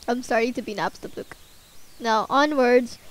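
ordinary talk, nothing marked

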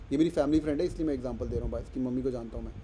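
ordinary talk, nothing marked

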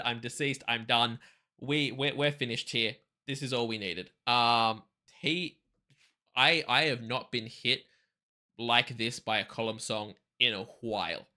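usual speaking voice

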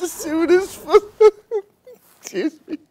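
giggling, trying to keep reading; choking back laughter on air; anchor stifling giggles. Real, not acted. Anchor breaks up reading plows.

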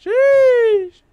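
very high, loud squealed exclamation; startled squeal. Real, not acted. Player startled mid-search, high squeal. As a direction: let out a piercing squeal of shock. Jump scare in game.

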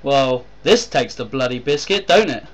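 outraged worker exclaiming; indignant. A narrator's reading. Harlow reacts to being left idle, high and loud.

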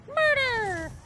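very high, loud screamed call; announcer yell. Pretend. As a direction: scream the finishing word. Announcer bit climax.

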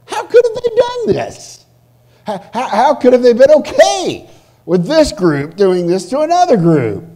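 high, loud, appalled questioning; incredulous question. Acted. Preacher voicing anyone who reads sad history, incredulous. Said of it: "And you raise this question".

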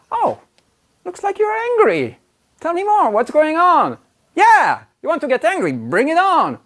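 eager inviting. Retold or imitated. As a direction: welcome her anger like a challenge you relish. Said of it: "women are craving for men who are able to be like".

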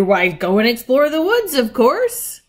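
loud, high and keen; eager exclamation. A narrator's reading. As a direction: urge them on to adventure. Peter eager to explore.

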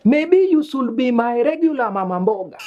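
normal talking voice

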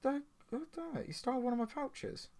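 high, soft protesting whine; indignant whine. Real, not acted. Gamer complaining to the bandit who robbed him, high whine. Pleading at thief in game.

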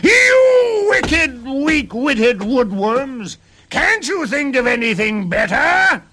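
shrieked, drawn-out insults at top pitch; high, loud dwarf tantrum; furious roar. A narrator's reading. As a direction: wail with rage, stretching the words. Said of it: "roared the little man".

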